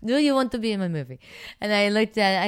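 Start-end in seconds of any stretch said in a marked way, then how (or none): 0.0-1.2 quick, higher, louder mock Italian accent; a hammed-up Italian accent; Dario's Italian accent. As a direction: lightly do the Italian director's broken English. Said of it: "Russian, I know, he's Italian".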